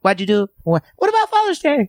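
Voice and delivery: put-on high, childlike voice asking; imitating a small kid looking up at him; small child impression. Mimicking a small child's voice to an interviewer, high and pleading. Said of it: "he does an impersonation of a small child".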